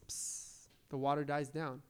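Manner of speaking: ordinary talk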